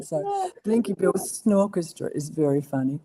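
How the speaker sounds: ordinary talk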